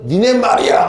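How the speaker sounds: high-pitched mock telling-off of everyone; acted group scolding. Teacher widening the blame to all students, acted angry scolding. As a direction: turn the scolding on the whole class. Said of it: "애들 다 혼내".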